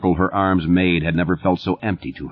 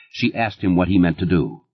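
ordinary talk

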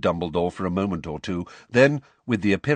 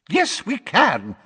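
high, loud, slow indignation; indignant; 12 semitones higher, 5 dB louder, slower than usual. Acted. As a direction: stretch each word in offence. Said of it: "said Missus Figg indignantly".

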